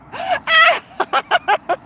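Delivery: high-pitched burst of laughter; laughing fit. Real, not acted. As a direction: crack up helplessly. Helpless laughter at a chat comment.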